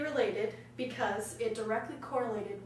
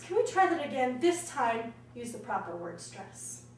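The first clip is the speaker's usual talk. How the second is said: stern teacher; 12 dB louder than usual. Acted. Teacher scolding a choir for wrong word stress, loudly. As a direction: bark the orders across the rehearsal room.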